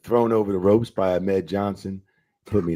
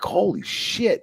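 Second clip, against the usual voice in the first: mock shock; 13 semitones higher, much slower than usual. Shocked reaction to fine.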